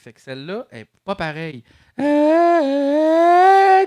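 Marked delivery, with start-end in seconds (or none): 2.0-3.8 high auto-tuned hum; robotic tuned tone; pitch correct effect. Shown as a demonstration. Chromatic pitch correct demo. (voix autotune)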